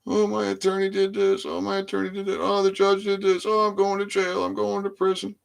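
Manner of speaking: high, sing-song mocking whine of a complainer; hammed-up whiny voice mocking excuses; mock whining. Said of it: "nobody wants to hear you whining and crying".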